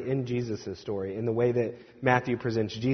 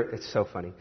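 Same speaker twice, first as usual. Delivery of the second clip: ordinary delivery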